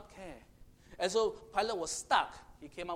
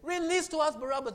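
high, loud protest; Pilate protesting; 7 semitones higher, 5 dB louder than usual. Retold or imitated. Pilate defending Jesus to the crowd, high and loud. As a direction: argue for his innocence. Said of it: "Pontius Pilate said".

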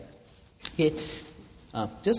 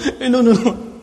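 laughing denial; 9 semitones higher, 10 dB louder than usual. Real, not acted. Correcting himself, laughing.

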